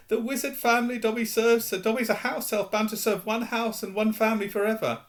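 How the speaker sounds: high, resigned elf voice; a servant bound forever; house elf. Acted. Dobby explains.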